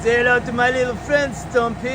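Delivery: a light playful gangster boast; mock movie gangster. Vlogger introducing the stump grinder as a mock gangster. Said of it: "movie quote introducing the grinder".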